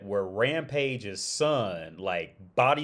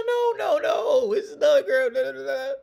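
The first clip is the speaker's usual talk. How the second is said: put-on high, loud Czech-accented wife insisting; mock Czech accent; 7 dB louder than usual. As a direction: mimic his Czech wife correcting him, hammed up. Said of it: "she got a little Czech accent".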